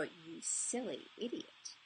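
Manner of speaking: slow, soft and seductive "You silly idiot"; sexy sultry voice. Shown as a demonstration. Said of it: "turned it into a sexy, sultry voice".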